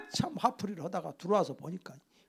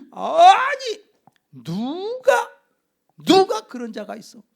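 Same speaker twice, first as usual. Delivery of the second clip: much higher and louder, outraged disbelief; king reacting to Esther's plea, astonished; shocked king; 10 semitones higher, 7 dB louder, much slower than usual. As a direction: burst out in sudden royal shock.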